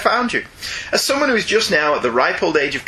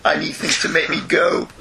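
ordinary talk, nothing marked

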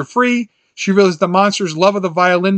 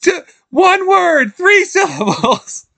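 higher and slow, miming charades; quoting Teri Garr; 11 semitones higher, slower than usual. Retold or imitated. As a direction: spell out the clue slowly, pitched up. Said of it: "she's like".

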